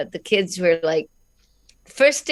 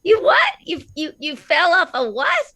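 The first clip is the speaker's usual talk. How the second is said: "What?" shot up much higher, baffled; baffled kids; 11 semitones higher than usual. Retold or imitated. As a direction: mimic confused classmates, pitch soaring. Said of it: "And they'd say".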